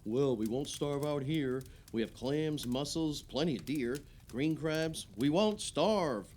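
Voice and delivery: mock local bravado. Retelling how islanders boasted after lockdown, mock bravado. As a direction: declare it like the defiant neighbours did. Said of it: "and everyone said".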